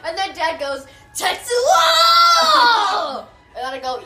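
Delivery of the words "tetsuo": high, long-drawn shout; dramatic name call. Retold or imitated. Quoting Dad's dramatic name call.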